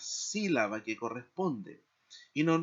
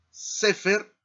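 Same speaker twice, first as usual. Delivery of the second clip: "sefer" high and loud, stressed on SE; stress on first syllable; 8 semitones higher, 7 dB louder than usual. Shown as a demonstration. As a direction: punch SE in "sefer". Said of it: "esa es la tónica". ("sefer" con la primera sílaba, SE, bien enfatizada)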